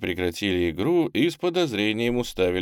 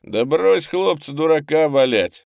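loud, high, good-natured retort; friendly snapping back; 8 semitones higher, 7 dB louder than usual. A narrator's reading. Boatswain brushing off the boys' suspicions, friendly snap. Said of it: "дружелюбно огрызнулся боцман".

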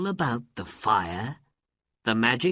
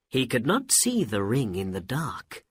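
plain reading voice

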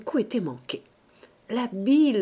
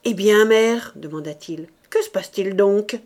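sévérité; 9 dB louder than usual. A narrator's reading. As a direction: question forte et sévère. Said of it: "l'air sévère". (ton sévère)

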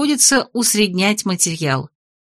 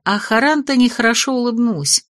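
ordinary delivery, nothing marked

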